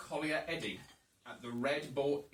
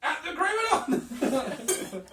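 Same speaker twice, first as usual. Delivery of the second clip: laughing; 12 semitones higher, 7 dB louder, much slower than usual. Real, not acted. Laughing misread.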